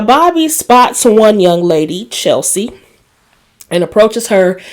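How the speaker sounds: ordinary talk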